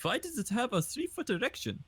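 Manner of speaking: put-on comic German accent, swinging in pitch. Quoting an anime parody for friends, comic German accent. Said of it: "That was from, uh, Hellsing Ultimate Abridged".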